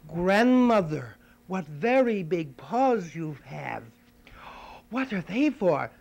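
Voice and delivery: put-on a child amazed, slowly. Girl staring at grandmother's paws, slow and wondering. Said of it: "And then she said".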